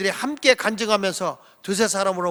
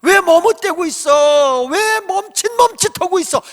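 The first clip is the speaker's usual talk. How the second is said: shouting; 8 semitones higher, 8 dB louder than usual. Retold or imitated. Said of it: "이렇게 외치는 거예요".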